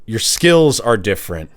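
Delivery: ordinary talk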